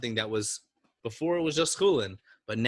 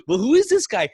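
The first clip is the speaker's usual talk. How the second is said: astonished angels; 11 semitones higher, 9 dB louder than usual. Retold or imitated.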